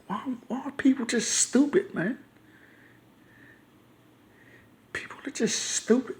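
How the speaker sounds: hushed, high-pitched exasperation; frustrated. Real, not acted. Vlogger despairing at stupid people, quietly frustrated. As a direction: plead softly in weary disbelief, nearly whispering by the end. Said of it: "sometimes I get frustrated".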